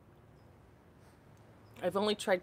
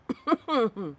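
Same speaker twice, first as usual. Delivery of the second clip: choking cough; 5 semitones higher, 7 dB louder than usual. Real, not acted. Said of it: "why I'm choking on my tea".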